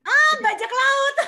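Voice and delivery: high, slow, laughing exclamation; amused exclamation. Real, not acted. Teacher reacting to child's pirate idea, laughing in surprise. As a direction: burst out high and drawn-out, then laugh.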